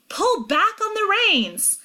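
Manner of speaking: high, drawn-out urgent yell; slow, high-pitched shouted command; screamed instruction. A narrator's reading. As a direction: scream it to a runaway rider. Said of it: "I scream".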